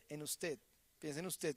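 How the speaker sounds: "piensa en usted" bajito, casi para sí; quiet repeat. Shown as a demonstration. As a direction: repite "piensa en usted" suave y bajo. ("piensa en usted" en voz baja e íntima)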